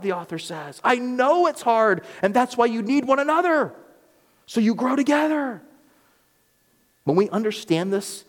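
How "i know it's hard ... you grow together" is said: fervent preaching. Acted. Pastor voicing the author's plea to the church, fervent preaching. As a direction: plead with them like a pastor.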